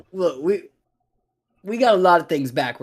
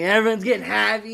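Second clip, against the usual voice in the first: ordinary delivery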